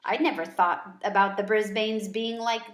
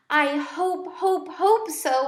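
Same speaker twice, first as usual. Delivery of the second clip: high, squeaky little hamster voice; a small hamster squeaking hopefully; 7 semitones higher, 4 dB louder, slower than usual. A narrator's reading. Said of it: "I squeaked".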